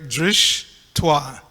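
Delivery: "drishtwa" in two slow parts, drish, then twa; correct syllable break. Shown as a demonstration. Teaching how to break the word, "drishtwa" as drish-twa. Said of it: "So you break".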